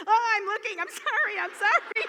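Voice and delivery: high, loud, embarrassed squeal; flustered apology. Retold or imitated. Caught peeking at friends in the spa, flustered high apology. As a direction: blurt out a caught-peeking sorry.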